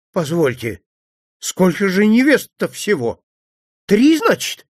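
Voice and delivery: shaky, rising to a high pitch in alarm; frightened old man counting, voice quivering; trembling voice. Acted. Priest frightened by a third bride, voice trembling. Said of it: "Голос священника дрожал".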